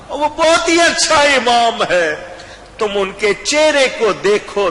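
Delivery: high, loud preaching peak; جوشیلا بیان; rising fervour. Acted. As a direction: lift the voice in praise. Preacher's climax.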